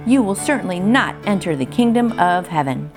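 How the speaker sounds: usual speaking voice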